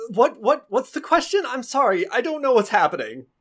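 high, hesitant, bewildered voice; flustered townsman. Acted. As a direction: stammer like a startled villager. Game master plays a flustered townsman.

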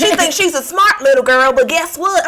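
put-on high, loud sassy child; sassy kid voice. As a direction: taunt like a smug kid. Voicing defiant kid.

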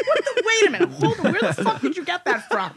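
high, laughing disbelief; stunned, half-laughing protest; incredulous outburst. Retold or imitated. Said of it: "I'm like".

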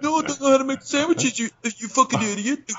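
high, goofy cartoon worker voice; silly high-pitched mock protest. Acts out mocking restaurant worker.

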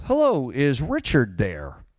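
slow, swooping sing-song phone greeting; drawn-out mock cold-caller voice; telemarketer impression. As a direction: imitate a telemarketer asking for a stranger. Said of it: "some telemarketer would go".

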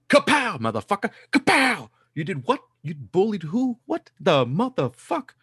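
loud mock parental fury, voice jumping up; mock furious dad. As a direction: play the outraged dad catching his kid bullying. Dad acting out punishing a bully.

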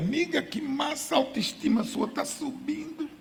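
squeal-like praise kept soft, imitating supportive friends; gushing girlfriends. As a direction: play the encouraging girlfriend in a high, light voice. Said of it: "As colegas".

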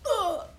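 high-pitched falling "ugh"; mock groan. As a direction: let out a mock frustrated "ugh". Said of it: "where you're like".

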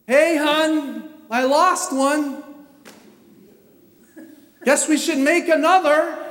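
loud, high imagined confession to wife; mock confession. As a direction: call it out in exasperated mock casualness.

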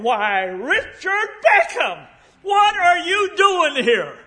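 put-on high, slow, astonished old woman's voice; startled old lady. Elderly lady startled by an old visitor, high and slow. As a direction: gasp it out as a stunned elderly lady.

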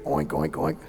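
low, soft imitated oinks; pig oinking. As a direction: imitate a pig's grunts in words. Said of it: "You're acting like a pig".

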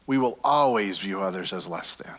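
ordinary delivery

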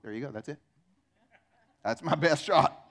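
ordinary delivery

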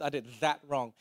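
"that" given the main weight; stress on THAT. Shown as a demonstration. Preacher confirming the emphasis, stress on THAT. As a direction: make "that" the key word. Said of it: "got the emphasis".

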